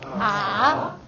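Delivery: AH dips down, then climbs back up; dipping third tone. Shown as a demonstration. Teacher models third tone for the class, falling then rising. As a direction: bend AH low and swing it up. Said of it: "don't want to overdo the contour".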